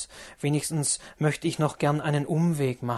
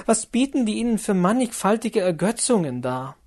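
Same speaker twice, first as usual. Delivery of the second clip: put-on higher, louder, urgent young woman's plea; Lotte pleading; 4 semitones higher, 4 dB louder than usual. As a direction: beg him to calm down, rising urgency.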